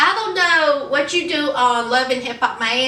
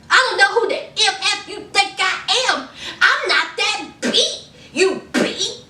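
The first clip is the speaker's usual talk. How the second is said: high, heated imitated tirade; going off; 5 semitones higher than usual. Host as [name] telling [name] off, heated and high. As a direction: rant at him in a raised pitch. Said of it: "She went all on [name]".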